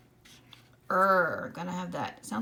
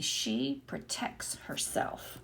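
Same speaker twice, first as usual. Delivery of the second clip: slow whispered reading; 4 semitones lower, much slower than usual. Shown as a demonstration. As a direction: whisper the sentence slowly. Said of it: "my sentence would be".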